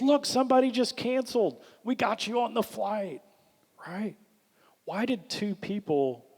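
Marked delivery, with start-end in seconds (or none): 0.0-3.2 lightly voiced agent, pitched up; upbeat retold quote, raised pitch; retold agent's good news. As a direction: deliver good news a bit higher. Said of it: "And she goes".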